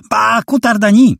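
very high, piercing whistle-like wail; wailing high-pitched cry. A narrator's reading. Terrified young woman wailing at the intruders, piercing high cry. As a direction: shriek it like a terrified girl. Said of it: "Jej głos, przenikliwie wysoki".

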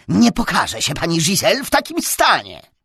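haughty nobleman. A narrator's reading. Nobleman continues ordering servant.

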